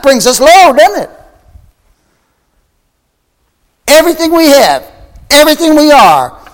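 high, loud pulpit exclamation; ringing, high-pitched proclamation; impassioned preaching. Real, not acted. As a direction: preach it out with full fervour. Preacher exclaims over Christ's power.

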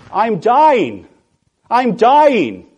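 high, loud mock wail of a hurt little boy; screaming. Little boy running to his mommy with a scratched arm, screaming. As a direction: cry out in overblown panic like a child. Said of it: "he is screaming out".

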